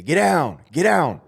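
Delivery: sharp, high, loud order; loud barked command over a crowd; commanding shout. Retold or imitated. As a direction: bark it out like a guard. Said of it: "in the P A system and say".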